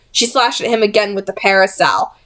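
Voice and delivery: plain reading voice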